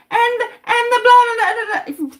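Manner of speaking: high, loud sneering blah blah, fading out; mocking high blah blah. Mocking the mother's outraged rant, high and sneering. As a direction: parody her rant as empty noise. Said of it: "She's saying".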